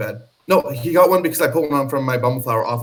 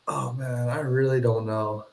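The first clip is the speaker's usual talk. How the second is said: ordinary talk, nothing marked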